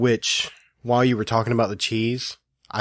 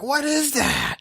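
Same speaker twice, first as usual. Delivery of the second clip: much higher mock-alarmed squeal; mock yell; slower than usual. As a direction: imitate her startled cry. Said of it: "I heard you yell".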